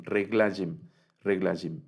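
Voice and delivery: "reglajim" with no vowel between g and l; silent sheva. Shown as a demonstration. Teacher repeating the correct reading, "reglajim" with the sheva silent. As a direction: say "reglajim" in three syllables, g joined to l. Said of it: "no suena".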